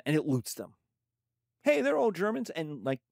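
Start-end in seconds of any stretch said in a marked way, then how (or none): 1.6-2.5 higher, brighter mock excuse; mock Nazi excuse, higher. Teacher voicing Nazi Germany again, much higher and mocking. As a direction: push the pitch well up, sell the excuse harder. Germany voiced justifying annexing Austria.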